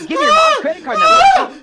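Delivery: very high, loud squeal; squeaky high voice. Pretend. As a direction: squeal the demand shrilly. Squeaky scam character.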